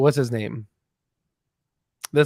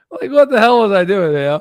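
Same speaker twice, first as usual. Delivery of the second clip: laughing; 7 semitones higher, 9 dB louder than usual. Real, not acted. Laughing at his own clip.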